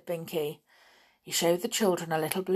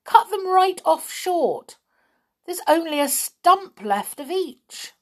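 horrified lament; 11 semitones higher, 6 dB louder than usual. A narrator's reading. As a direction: lament it loudly, aghast. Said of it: "groaned Binky".

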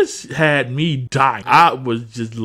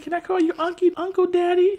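put-on high little-kid voice. The kid asking Bernie, high and sweet. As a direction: ask it sweetly like a small child. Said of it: "He's like".

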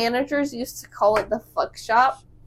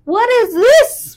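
alarmed mother; 11 semitones higher, 9 dB louder, slower than usual. Retold or imitated. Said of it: "my mother is like".

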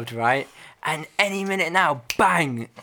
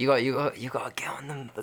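urgent whispered aside; 3 semitones lower, 6 dB quieter than usual. Real, not acted. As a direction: drop to a breathy whisper. Urging listener onto wavelength.

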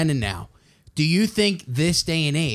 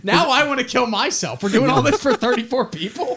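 laughing; 8 semitones higher than usual. Real, not acted. Mock despair over small audience.

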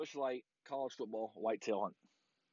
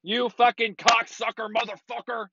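real road-rage yell, much higher; angry yell; 10 semitones higher than usual. Real, not acted. Driver yelling at another car through the windows, angry yell. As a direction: bellow insults at the passing car.

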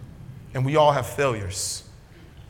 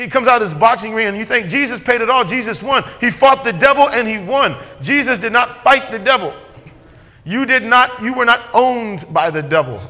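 high, loud preacher drive; a pastor building up steam; full force preaching; 7 semitones higher, 6 dB louder than usual. Acted. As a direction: preach it up to the back row.

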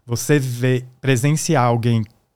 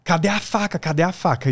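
retold yell; 6 semitones higher than usual. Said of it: "apareceu e gritou".

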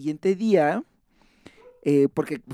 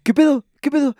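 high, loud startled questions; retold alarm; 9 semitones higher, 7 dB louder than usual. Son reacting to news of mamá in hospital, alarmed. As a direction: act out a sudden panicked check. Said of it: "ya súper espantado".

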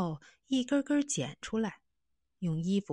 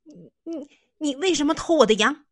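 high, loud, fast accusing cry; a boy bursting out in anger; loud angry shout; 9 semitones higher, 10 dB louder than usual. Acted. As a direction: yell it at the thief. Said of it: "大声说".

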